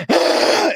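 high loud wail; mock anguished wail. Co-host acting out a crying steroid kid, loud wail. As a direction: let out a sudden pained cry. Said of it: "he's sitting just like".

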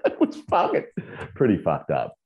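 normal talking voice

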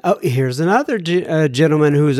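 ordinary talk, nothing marked

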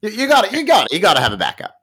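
usual speaking voice

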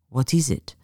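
put-on lightly French-accented question; a touch of French, understated; subtle French accent. Woman asking the accountant about his problem, subtle French accent. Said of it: "softened by a subtle French accent".

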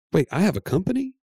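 incredulous question, voice jumping high; astonished wife. Retold or imitated. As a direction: act out her stunned disbelief. Retold wife's astonished discovery.

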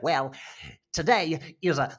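a hint of [name]'s speaking voice; [name] impression. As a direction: lightly imitate her interview voice. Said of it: "the way that she's like".

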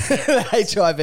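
laughing through the words. Real, not acted. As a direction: say it while laughing. Laughing at covid joke.

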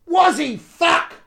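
high Cockney jeer from the back; loud Cockney shout from the back; Cockney heckle. Retold or imitated. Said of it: "an authoritative Cockney voice".

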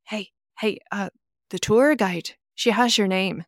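a light Irish bus driver voice; lightly imitating an Irish bus driver; Irish accent. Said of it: "Well done on the accent".

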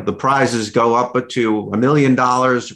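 ordinary delivery

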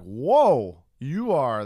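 loud, startled whoa; big, loud reaction of disbelief; shocked. Retold or imitated. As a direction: recoil out loud in retold shock. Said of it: "but it shocks me".

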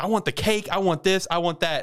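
high-pitched mock greedy demands; demanding child. Girl runs off to try all food, imitated demanding. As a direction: rattle off wants like an eager spoiled kid.

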